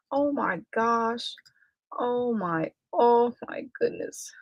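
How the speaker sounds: horrified at herself, dropping to a whisper; dismayed realization. Real, not acted. Remembering she forgot to send the link, hushed dismay.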